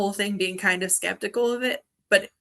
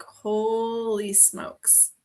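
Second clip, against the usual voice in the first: long drawn-out, awed exclamation; stunned reaction; 3 semitones higher, much slower than usual. Retold or imitated. Storyteller recalls seeing the third person in the picture, stunned. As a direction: stretch it out slowly in retold amazement.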